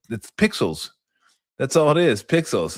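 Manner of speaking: usual speaking voice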